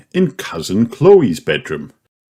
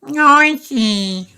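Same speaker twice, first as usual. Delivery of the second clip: put-on sleepy toddler. A narrator's reading. Sleepy George complains.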